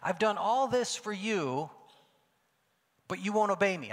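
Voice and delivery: accusing. Shown as a demonstration. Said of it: "I don't believe what he's doing here is he's saying".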